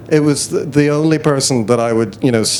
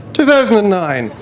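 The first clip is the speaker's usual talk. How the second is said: surprised realisation; 9 semitones higher than usual. Real, not acted. Recalling the year, surprised.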